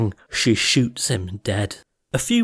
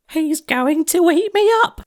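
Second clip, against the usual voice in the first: very high, louder frightened grandma; terrified cry; 4 dB louder than usual. Acted. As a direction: shriek it in panic. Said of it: "she cried".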